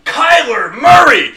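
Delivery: loud, slow shouted announcer call; home run call. Pretend. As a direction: belt it out like a stadium announcer. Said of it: "that would be great in a home run call".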